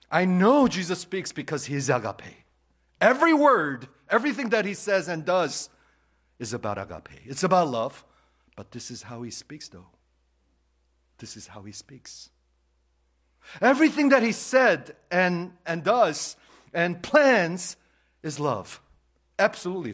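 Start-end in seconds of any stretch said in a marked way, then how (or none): 0.1-8.0 loud, high, ringing pulpit voice; full-force preaching. Acted. 13.6-19.9 loud, high, ringing pulpit voice; full-force preaching. Acted.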